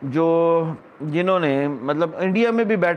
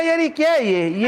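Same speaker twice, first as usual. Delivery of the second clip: high-pitched retold protest; indignant question; 9 semitones higher than usual. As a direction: ask it like you can't believe this. Retells own indignant question. (اونچی آواز میں ناگواری بھرا سوال)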